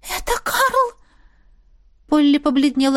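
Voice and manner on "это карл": high, drawn-out frightened gasp of words; alarmed. A narrator's reading. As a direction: announce him in hushed alarm, pitched high. Maid panics as her lover approaches.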